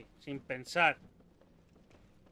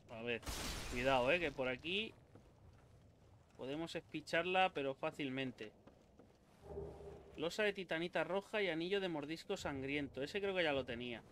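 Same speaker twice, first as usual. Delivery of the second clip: usual speaking voice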